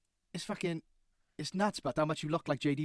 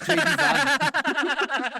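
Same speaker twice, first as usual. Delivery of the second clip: laughing; 10 semitones higher, 6 dB louder, much slower than usual. Real, not acted. Laughing at the lookalike photo.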